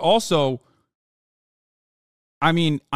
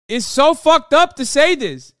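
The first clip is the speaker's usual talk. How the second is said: yelled much higher and louder; furious shout; 10 semitones higher, 7 dB louder than usual. Real, not acted. Streamer yelling at pundit's clip, furious shout. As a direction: explode at the screen.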